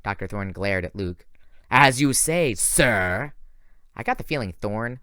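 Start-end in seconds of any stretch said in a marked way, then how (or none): 1.7-3.3 put-on grudging French-accented reply. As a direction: bite out sir in a French accent. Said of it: "weird French accent".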